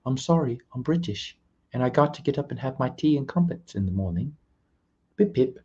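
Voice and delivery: Luke. Retold or imitated. Imitating a British friend who must get up for tea, hammed-up British accent. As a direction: badly fake a polite British accent. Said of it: "I'm gonna say that was Luke".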